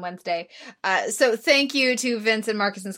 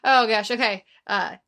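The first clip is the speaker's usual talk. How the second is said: ordinary delivery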